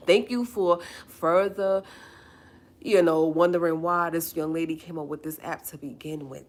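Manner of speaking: thanking the man who proved her point, loud sarcastic thanks. Acted. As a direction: thank him with heavy sarcasm.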